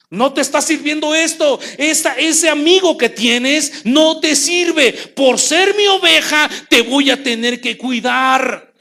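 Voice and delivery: the good shepherd warning his sheep; firm and caring. Acted. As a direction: promise protection slowly.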